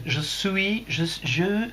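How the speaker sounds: slow, exaggerated English-accented French. Streamer reading out Jean-Daniel's message, English accent. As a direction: labour each French word with an English accent. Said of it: "Tu es anglais, Jean-Daniel".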